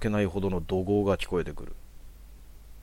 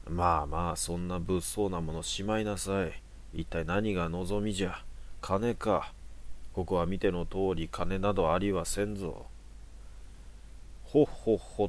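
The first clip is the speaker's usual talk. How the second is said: put-on soft, soothing old priest; calm an armed man like a grandfather; creaky wise old man; 4 dB quieter than usual. Priest soothes knife-wielder.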